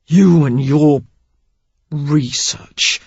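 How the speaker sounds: man sneering at research; scornful. Acted. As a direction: spit out research as if obscene. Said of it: "as if it were obscene".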